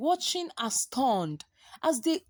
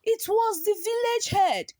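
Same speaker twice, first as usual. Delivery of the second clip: shocked realization; 8 semitones higher than usual. A narrator's reading. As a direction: cry out as the truth dawns. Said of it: "the warriors gasped".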